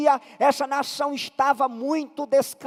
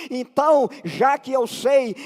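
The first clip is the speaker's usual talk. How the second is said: ordinary delivery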